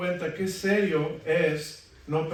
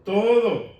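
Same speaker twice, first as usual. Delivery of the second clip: "Todo" said higher and louder, landing hard; emphatic echo; 5 semitones higher, 8 dB louder than usual. Acted. Preacher echoing the congregation's answer, "Todo" stressed. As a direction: punch the word "Todo" as the answer.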